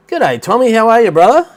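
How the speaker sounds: high, loud hello to a mate; cheery greeting. Real, not acted. Greeting mate Tommy in the stream chat, cheery and high. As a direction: call out to a friend joining the stream.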